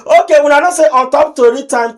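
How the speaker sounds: ordinary talk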